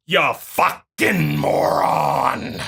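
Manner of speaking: slow, low, scornful drawl; contemptuous insult. Real, not acted. Telling the opponent off with a slow scornful insult. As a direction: spit the insult out slowly.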